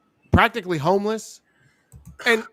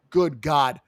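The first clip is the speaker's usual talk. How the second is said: ordinary talk, nothing marked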